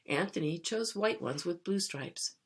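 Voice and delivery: ordinary delivery, nothing marked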